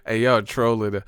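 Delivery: ordinary talk, nothing marked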